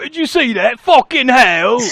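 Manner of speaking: high, loud mock British soldier, drawn-out curse; British soldier impression. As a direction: ham up a shocked British squaddie. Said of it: "trying to sound like one of those soldiers".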